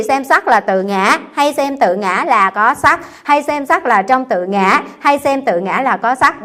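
solemn scripture reading voice; sutra recitation. Acted. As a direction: intone the sutra deliberately, word by word. Re-reading the four clauses.

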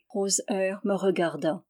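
usual speaking voice

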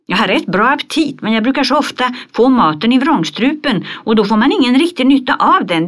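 put-on a chattering, earnest little girl listing symptoms; lively Pippi. Pippi's long symptom speech. (högt, ivrigt flickröst som Pippi)

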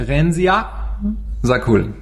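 eigenen Namen rückwärts sprechen; backwards speech. Pretend. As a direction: Rensia Sakul, rückwärts gelesen. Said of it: "Der Lukas kann wirklich rückwärts sprechen". (Name rückwärts ausgesprochen)

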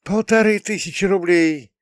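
high-pitched astonished exclamation; amazed mother. A narrator's reading. Amazed mother to her son on the way to the metro, astonished. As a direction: marvel at the sum in a high voice. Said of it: "не переставала изумляться мама".

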